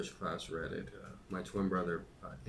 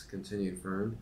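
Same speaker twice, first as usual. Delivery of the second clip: usual speaking voice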